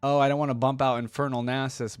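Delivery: ordinary talk, nothing marked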